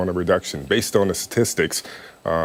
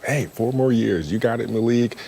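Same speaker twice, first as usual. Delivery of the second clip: imitated crowd shout; 4 semitones higher than usual. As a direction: mimic supporters calling out, at talking volume. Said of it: "you hear people shout".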